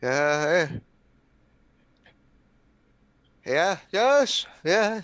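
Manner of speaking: high, loud, desperate yeses; panicked yes. Acted. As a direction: blurt frantic yeses in character. Acting frenzied character answering comforter.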